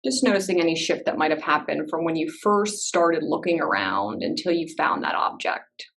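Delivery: unhurried meditation guide with long pauses; calm, spacious instructions, leaving silence between phrases; guided meditation. Real, not acted. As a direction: ease listeners gently into body awareness.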